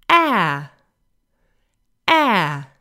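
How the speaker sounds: "ah" as the vowel in "apple"; isolated short A for "ah", held for learners; short A sound. Shown as a demonstration. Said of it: "This is our short A sound".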